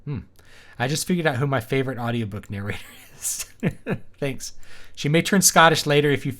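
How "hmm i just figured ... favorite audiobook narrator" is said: plain reading voice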